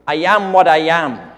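loud, failed attempt at a cartoon sailor accent; Popeye. Preacher quoting a cartoon sailor to the congregation, poorly imitated accent. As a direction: have a half-hearted go at the sailor's catchphrase, loudly. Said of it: "I can't do the accent".

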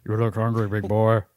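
lightly voiced hero sliding into Shaq; drifting celebrity impression, understated; Harrison Ford impression. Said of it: "drifted into Shaquille O'Neal".

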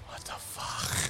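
whisper. Real, not acted. Whispered aside.

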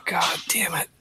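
softly whispered swear; whispered curse. Acted. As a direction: breathe the curse out. Hungover muttering.

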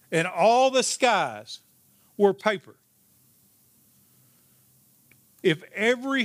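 preacher swelling with passion; building sermon paraphrase. Acted. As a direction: build the image line by line with fervour. Paraphrases hymn with rising passion.

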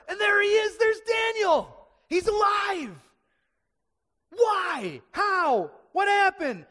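high, shouted amazement; excited proclamation. Acted. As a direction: shout the astonishing discovery to the crowd. Preacher proclaims Daniel is alive.

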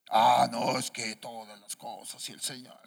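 put-on low voice of a religious know-it-all; hammed-up pious mumbling; mock piety. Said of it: "Muy religiosos ustedes".

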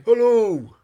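high, loud hammy "Hello!"; big theatrical holler; booming greeting. Retold or imitated. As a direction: bellow a greeting to the back row. Bellowing impression of an actor.

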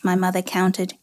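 ordinary delivery, nothing marked

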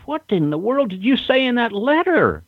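imitating an astonished wrestler, voice jumping high; stunned, how did you pull that off; amazed question. Astonished wrestler asking Rock about the letter, voice high.

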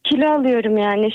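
normal talking voice

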